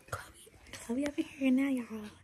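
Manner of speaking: hushed whisper to camera. Real, not acted. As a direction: keep it down, whisper. Whispering near sleeping roommate.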